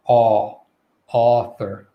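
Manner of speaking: aw wide open, then author on that vowel; long back aw leading into author; jaw-dropped AW. Shown as a demonstration. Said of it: "dropping our jaw all the way down".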